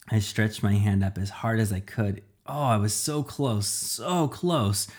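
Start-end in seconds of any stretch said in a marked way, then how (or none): none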